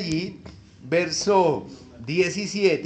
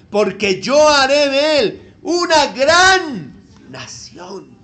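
loud, slow heavenly proclamation; booming angel voice; 5 semitones higher, 9 dB louder, slower than usual. Acted. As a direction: call down from heaven, commanding. Angel's voice from heaven, read aloud.

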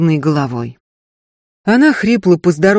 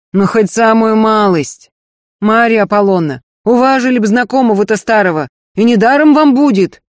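higher, louder pleading persistence; insistent coaxing; 5 semitones higher, 5 dB louder than usual. Acted. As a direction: keep wheedling, won't let up. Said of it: "не унимался".

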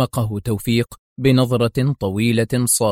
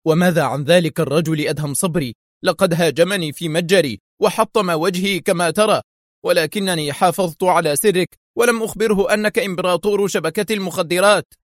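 raised, tense pitch; worried exclamation; tense cry; 7 semitones higher than usual. A narrator's reading. Tawfiq complains about Adham, tense. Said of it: "هتف توفيق في توتر".

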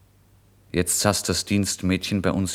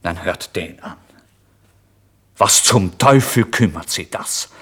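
angry retort; 7 dB louder than usual. Acted. Landlord turns on the narrator.

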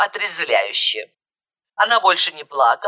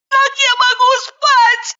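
high, slow, angry wail; angry cry; 4 dB louder, slower than usual. A narrator's reading. Zhanna angrily crying out to her friend, high and slow. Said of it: "зло закричала она".

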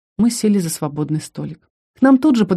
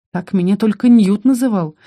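ordinary delivery

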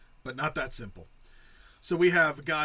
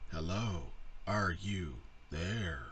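slow, low, soft robot voice; halting robot, word by word; computer voice; 5 semitones lower, 6 dB quieter, much slower than usual. Retold or imitated. Mimicking the movie computer's reply, slow computer voice. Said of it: "very fucking annoying computer voice".

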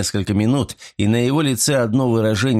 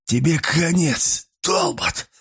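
furious croak; 7 semitones higher, slower than usual. A narrator's reading. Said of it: "остервенело каркнул Ройл".

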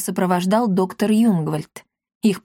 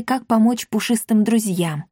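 ordinary delivery, nothing marked

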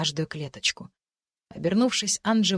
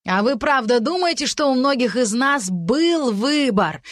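near shout; 6 semitones higher, 5 dB louder than usual. Acted. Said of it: "почти прокричал первый демон".

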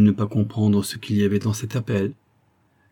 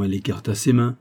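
ordinary delivery

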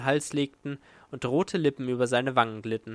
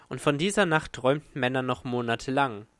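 ordinary delivery, nothing marked

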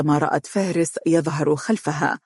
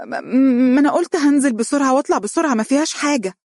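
high, rushed, stammering self-defence; flustered; 9 semitones higher, 3 dB louder, faster than usual. A narrator's reading. Girl justifying her quick trip downstairs, flustered. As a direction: blurt a nervous excuse at high pitch. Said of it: "تنحنحت مضطربة وقالت على الفور".

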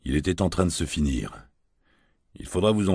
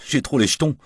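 naive laugh; 9 semitones higher, 5 dB louder than usual. A narrator's reading. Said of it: "avec une sincère naïveté".

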